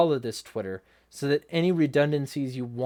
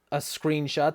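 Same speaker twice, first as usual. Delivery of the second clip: ordinary talk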